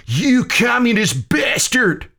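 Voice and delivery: furious, high-pitched outburst; spat insult. A narrator's reading. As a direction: spit the insult out in outrage. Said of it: "McCreary spat".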